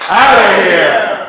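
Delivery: drawn-out shout building on OUT; slow, loud announcer's dismissal; umpire-style call-out. Shown as a demonstration. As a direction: start plain, then bellow the ejection. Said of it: "we will get to call them out".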